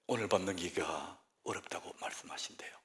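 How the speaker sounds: 낮게 속삭이는 비보; hushed grave whisper. Retold or imitated. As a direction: drop to a whisper for the dying. Retold grave news, hushed.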